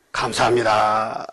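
low, slow, drawn-out thank-you; humble thanks. Acted. Models a grateful answer. (낮고 느리게, 공손히 감사하는 말투)

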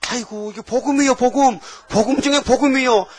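high, loud overjoyed cry of gospel; delighted exclaim. Retold or imitated. Caller telling the pastor she found the gospel, overjoyed and loud. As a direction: gush like she found treasure. Woman's delighted reaction quoted.

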